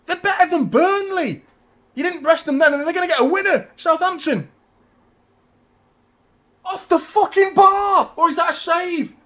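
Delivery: high and loud, still raging; angry shouting. Real, not acted. Fan still raging at Pep as Southampton threaten, loud high shouting. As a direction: keep yelling at the screen. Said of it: "I'm fuming with Pep".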